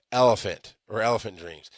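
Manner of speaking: ordinary talk, nothing marked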